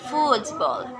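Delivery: football with a high FOOT; football, first part stressed; stress on first term. Shown as a demonstration. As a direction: raise FOOT in football, then fall. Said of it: "stress placement is in the first term".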